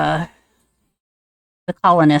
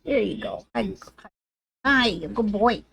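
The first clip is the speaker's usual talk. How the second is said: softer, tender praise for the pet; pet talk; 2 semitones higher, 9 dB quieter than usual. Real, not acted. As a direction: hush down and praise him gently.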